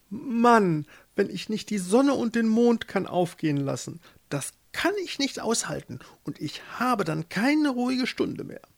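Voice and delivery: high, lamenting grand voice; imperious wife, distressed. A narrator's reading. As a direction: complain to your husband as if unbearable. Voices the pope Ilsebill. (herrische Päpstin, hoch und klagend)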